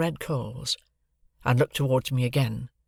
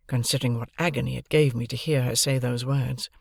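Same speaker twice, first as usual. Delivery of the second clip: ordinary delivery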